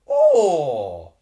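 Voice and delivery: loud, high cry of disbelief; outraged cry. Real, not acted. Streamer sees a foul not given, crying out loud in outrage. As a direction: let out a loud pained "oh".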